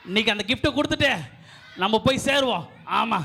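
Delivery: ordinary talk, nothing marked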